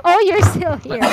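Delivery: laughing through the words; laughing echo. Retold or imitated. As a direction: repeat the jab while cracking up. Echoing friend's jab, laughing.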